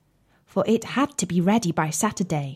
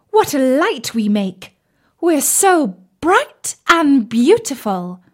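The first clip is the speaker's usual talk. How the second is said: high, loud, vain boasting; boastful; 5 semitones higher, 4 dB louder than usual. Acted. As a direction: crow about their brilliance. Matches boast while burning.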